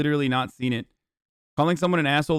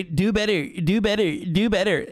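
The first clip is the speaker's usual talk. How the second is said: mock scolding; 6 semitones higher than usual. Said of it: "I'm wagging my finger at you".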